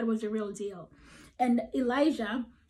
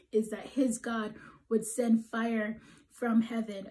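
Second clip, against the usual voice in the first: ordinary talk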